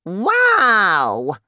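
high, loud drawn-out exclamation; shouted. A narrator's reading. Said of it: "Grandma shouted".

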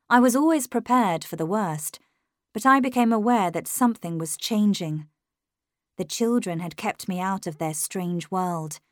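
plain reading voice

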